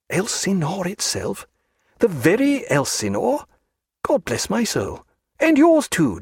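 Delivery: high-pitched, swooping wonder; awed delight. A narrator's reading. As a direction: marvel at a famous sight, voice lifted high. Character marvels at seeing Elsinore.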